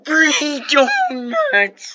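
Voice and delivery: high, loud, drawn-out yell of joy; mock excited cry. Imagining a kid next day at school, hammed-up excited cry. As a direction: stretch it out in hammed-up delight. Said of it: "be like".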